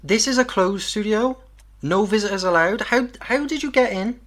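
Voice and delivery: taken aback, demand answers from the stranger; startled challenge. Acted. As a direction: pitch jumps up, alarmed and questioning. Engineer challenges sudden intruder in studio.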